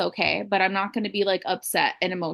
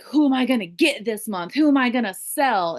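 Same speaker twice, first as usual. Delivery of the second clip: mock pushy hustler, a bit louder; aggressive sales mindset; 3 semitones higher, 4 dB louder than usual. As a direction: push it like a seller chasing targets. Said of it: "more like, aggressive".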